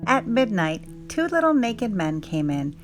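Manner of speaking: ordinary delivery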